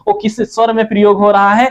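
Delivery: ordinary talk, nothing marked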